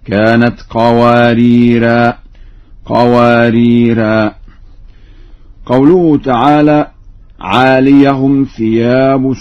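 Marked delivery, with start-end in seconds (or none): 0.1-4.3 قواريرا ending in long "aa", no n; slow, loud "qawariraa", pausing after each قواريرا; stops on alif. Shown as a demonstration. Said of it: "وقف عليهما بالألف". (قواريرا بالوقف على الألف)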